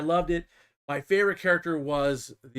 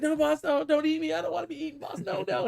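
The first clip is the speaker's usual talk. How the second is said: very high, panicky mock begging; imitated grot begging for its life; pleading grot; 13 semitones higher than usual. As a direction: squeal like a terrified little minion. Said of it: "and he's like".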